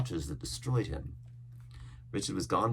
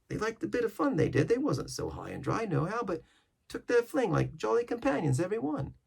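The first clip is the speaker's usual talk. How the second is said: much higher sailor's voice; sailor reminiscing; 13 semitones higher than usual. Acted. Coxswain praising old shipmates, high sailor voice. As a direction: fondly stick up for the old crews.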